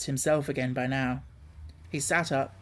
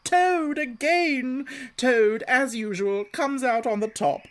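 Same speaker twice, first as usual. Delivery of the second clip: gleeful boasting; 9 semitones higher, 4 dB louder than usual. Acted. Toad crowing over his escape, high and gleeful. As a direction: gloat to yourself.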